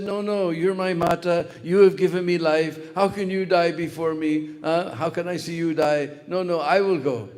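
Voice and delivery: a calf begging to die in its mother's place; arguing calf. Retold or imitated. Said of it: "they were arguing like this".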